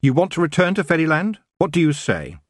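high, insistent questioning; demanding. A narrator's reading. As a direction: press the elf for an answer. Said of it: "she demanded".

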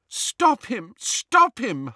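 high, distressed cry; urgent cry. A narrator's reading. Hermione begging as the elf hurts himself, crying out. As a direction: cry out for someone to step in. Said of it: "Hermione cried".

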